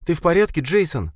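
high, anxious woman's question. A narrator's reading. Linda asking Jason if he's okay, anxious. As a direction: ask with worry. Linda worried about the boy.

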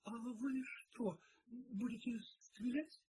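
high, slow, hesitant question; timid. A narrator's reading. Maid asks whether detective will shoot, timid. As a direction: ask haltingly in a small high voice. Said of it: "робко проговорила она".

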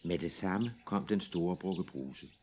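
ordinary delivery

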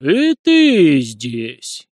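slow, loud, drawn-out amazement; astonished exclamation. A narrator's reading. Priest amazed to find Fimka with the boys: slow, loud astonishment. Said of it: "изумлённо обвёл всех присутствующих глазами священник".

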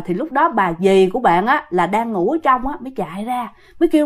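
ordinary delivery

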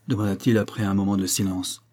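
plain reading voice

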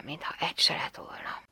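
hushed narration; whispered. A narrator's reading. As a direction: breathe it out in a whisper. (suttogva, elhaló hangon)

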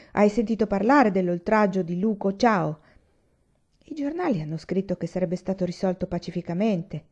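plain reading voice

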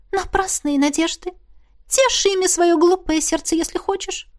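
put-on high-pitched ghost girl voice; dismissive ghost. A narrator's reading. Ghost mocks the girl's hopes, high and dismissive.

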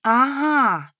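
high, stretched, puzzled voice; lost, lingering acknowledgement; bewildered drawn-out. A narrator's reading. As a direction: draw it out in bewilderment. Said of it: "протянула я растерянно".

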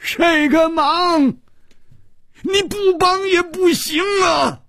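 strained enraged cry; angry shout. A narrator's reading. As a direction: scream the demand, stretching the words. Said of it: "他怒喊道".

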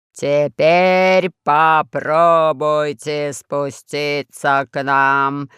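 низкий хриплый, очень медленно; медленно, по слогам, хрипло; low hoarse voice. A narrator's reading. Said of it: "поверил страшному голосу". (низким хриплым голосом, по слогам)

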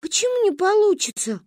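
put-on high-pitched, hurt child's protest; offended question. A narrator's reading. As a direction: question it like an offended little girl. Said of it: "обиделась Манька". (обиженно, высоким голосом)

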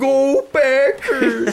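high, slow Minnesota fan cheer; Minnesotan cheer. Pretend. Cheering for the Packers as a goofy Minnesota fan. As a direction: draw it out like a Minnesota sports fan. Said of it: "more like Minnesotan accent".

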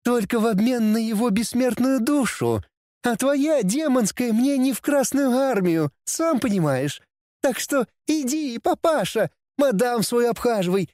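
high brisk cheeky demoness; sassy demoness voice. A narrator's reading. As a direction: rattle it off like a busy she-demon.

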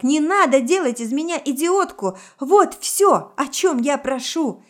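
exasperated, loud demand; indignant outburst. Acted. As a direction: protest heatedly, pitch up. Same shrieked outburst continues.

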